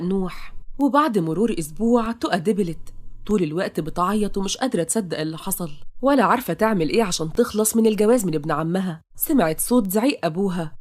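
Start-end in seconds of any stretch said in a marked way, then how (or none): none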